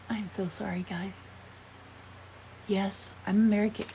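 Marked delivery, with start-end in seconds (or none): 0.1-1.1 whispered apology. Real, not acted. Apologising for messy lipstick.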